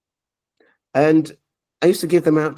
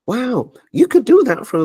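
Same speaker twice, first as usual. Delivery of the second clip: higher and louder, full of wonder; amazed friend; 10 semitones higher, 5 dB louder than usual. Retold or imitated. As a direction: pitch it up with delight.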